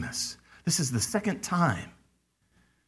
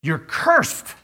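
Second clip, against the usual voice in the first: pastor cursing congregation; 3 dB louder than usual. Acted. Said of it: "a pastor going to his congregation and saying".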